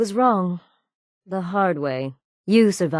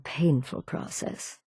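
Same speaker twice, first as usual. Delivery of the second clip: slow, breathy hushed threat; a whispered promise of pain; menacing whisper; 2 semitones lower, 7 dB quieter, slower than usual. Acted. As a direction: savour the cruelty under your breath. Gloating over a slow killing.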